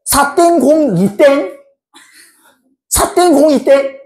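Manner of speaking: "사 땡 공 이 땡" slow and high, piece by piece; mock dotted reading. Shown as a demonstration. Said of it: "이렇게 읽을 거야?". ("사 땡 공 이 땡"을 높게 또박또박 끊어 묻듯이)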